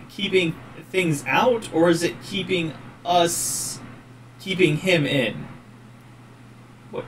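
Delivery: usual speaking voice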